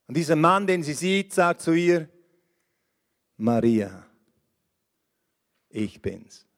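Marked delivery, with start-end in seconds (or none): 3.4-6.4 much lower and softer, gently naming her; tender call. Acted. Jesus gently revealing himself to Maria, tender and low. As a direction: say her name tenderly, barely above a hush.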